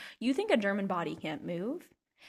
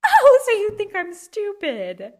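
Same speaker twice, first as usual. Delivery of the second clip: high, loud, drawn-out indignation; mock outrage; 10 semitones higher, 12 dB louder, slower than usual. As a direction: blow up as if deeply insulted. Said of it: "I'm like".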